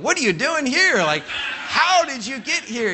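high mock astonishment, slowing on the second question; imitating people amazed he moved from California; baffled disbelief. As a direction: play the stranger who can't believe it. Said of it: "Every time I tell somebody that".

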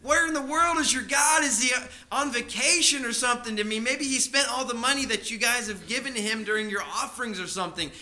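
loud, high, ridiculing call; mocking taunt. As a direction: jeer at them from the mountain. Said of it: "laughing at him and saying".

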